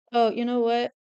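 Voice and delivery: ordinary talk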